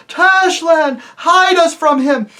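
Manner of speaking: loud, high, wailing plea; terrified howl. Acted. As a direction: cry out in panic to the god. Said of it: "moaned and howled and called out".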